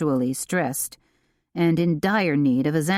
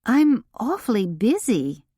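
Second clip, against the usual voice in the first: stalling; 6 semitones higher than usual. A narrator's reading.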